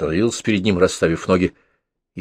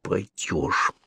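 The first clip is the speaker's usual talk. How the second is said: softer, stubborn, through gritted teeth; 7 dB quieter than usual. A narrator's reading. Petya stubbornly orders his brother, through gritted teeth. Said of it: "упрямо процедил Петя сквозь зубы".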